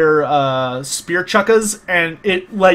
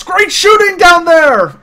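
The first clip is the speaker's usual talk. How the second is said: high, drawn-out yell across the deck; captain's shout; 12 semitones higher, 9 dB louder, slower than usual. Acted. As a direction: holler it like a ship's captain cheering the gunners.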